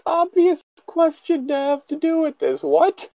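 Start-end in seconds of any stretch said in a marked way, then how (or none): none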